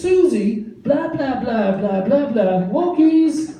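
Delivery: high dog-talk tune filled with gibberish; what dogs hear. Acted. Owner's words as dog hears them, high excited babble. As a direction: mimic excited pet talk, nonsense between the keywords. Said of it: "What the dog actually hears is".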